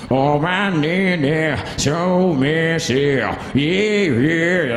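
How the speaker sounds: slow, slurred mock singer mumble; mumbled rock verse. Mocking a rock singer's unintelligible verse, slow and slurred. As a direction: mush the lyrics like a lazy singer. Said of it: "never tell what they say in the verse".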